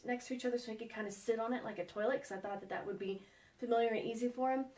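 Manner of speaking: normal talking voice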